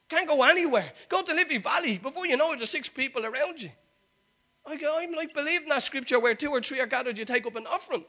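high, very loud preacher's shout; roaring the sermon out to a whole congregation; fired-up preaching. Real, not acted. Preaches at full force.

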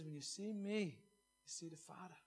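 soft and slow, letting the words sink in; quiet, deliberate reverent restatement. Shown as a demonstration. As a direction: repeat it gently, almost under the breath. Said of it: "Jesus says".

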